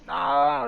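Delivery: loud mock scream of agony; death scream. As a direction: cry out as if electrocuted. Victim is shocked to death.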